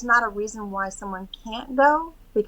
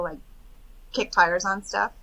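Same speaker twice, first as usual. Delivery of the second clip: usual speaking voice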